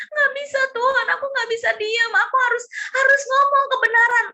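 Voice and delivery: high-pitched pleading with God, rushing at the end; desperate protest. Acted.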